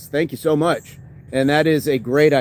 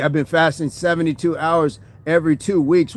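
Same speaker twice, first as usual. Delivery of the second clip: usual speaking voice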